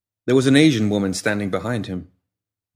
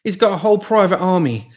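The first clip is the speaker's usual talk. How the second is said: frantic; 10 semitones higher, 5 dB louder than usual. A narrator's reading. Pimp confessing in fear.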